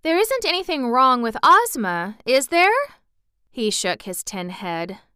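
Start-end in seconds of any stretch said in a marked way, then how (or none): 0.0-2.7 higher, louder questioning voice; raised pitch, lifting into the question; Dorothy. A narrator's reading. Little girl asking the anxious Emperor about Ozma, concerned.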